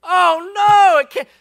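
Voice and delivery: high, loud panicked shout; high startled shout of fear; frightened cry. Acted. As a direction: yelp in dread at the sight. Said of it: "tell me it's not him".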